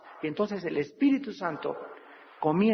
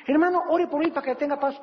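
pleading churchgoer; 7 semitones higher, faster than usual. Retold or imitated. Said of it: "Un pastor no le puede dar la paz".

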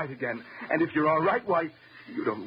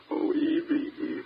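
frightened plea; 14 semitones higher than usual. Acted. Husband pleading with armed wife.

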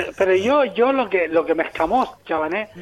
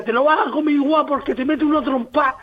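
higher, scolding mother voice; madre regañando; 7 semitones higher than usual. Acted. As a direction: threaten like a mom fed up with the fussy kid. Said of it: "Y mi madre me decía". (madre que regaña, en tono agudo)

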